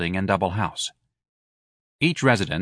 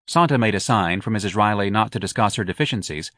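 plain reading voice

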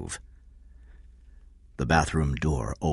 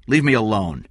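less shouted, falling brush-off; raised but contained refusal; firm dismissal; 5 semitones higher, 5 dB louder than usual. Acted. Eddie rejects Kathy's company, firm dismissal.

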